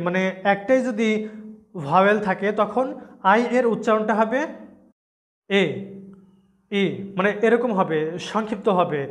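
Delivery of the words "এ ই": short clipped "ই" as in "ফিন"; short vowel demo; short i sound. Shown as a demonstration. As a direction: cut the vowel short, not "আই". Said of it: "short sound হবে তখন".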